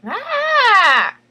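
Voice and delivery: high loud shocked shriek; shocked scream. Acted. Lucretia screaming at her sister's news. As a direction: let out a sudden scream of shock. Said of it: "and she's like".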